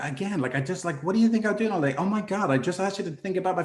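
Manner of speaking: high, fast mock-exasperated wife; imitating wife's angry rant. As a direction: rattle it off as the fed-up wife, pitch way up. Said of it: "she's like".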